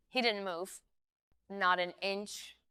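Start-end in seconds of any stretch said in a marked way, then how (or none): none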